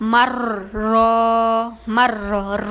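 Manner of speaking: মার র with both Rs heavy and trilled; both R thick. Shown as a demonstration. Teacher shows both R thickened, heavy মার র. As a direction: roll মার, then hold a full র. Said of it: "কিভাবে মোটা করতে হবে দেখেন".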